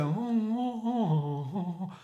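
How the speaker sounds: wordless crooning oohs, sliding downward. Acted. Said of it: "then you'd have Elvis then".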